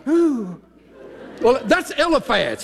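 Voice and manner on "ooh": high drawn-out ooh; a startled old lion's ooh; mock lion groan. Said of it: "this old guy is going".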